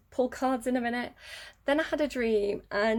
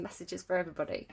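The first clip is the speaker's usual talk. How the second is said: ordinary talk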